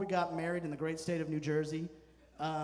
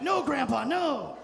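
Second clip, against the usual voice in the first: higher, louder mock protest; mock alarmed refusal; 8 semitones higher, 8 dB louder, slower than usual. Grandson recoiling from grandpa's handshake, higher, louder mock protest. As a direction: recoil with a loud, high "no".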